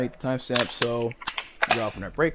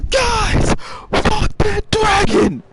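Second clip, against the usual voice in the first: very high, loud, stretched-out shout; imitated furious yell; 11 dB louder, much slower than usual. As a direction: holler it slowly as a raging friend. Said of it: "he's gonna be like".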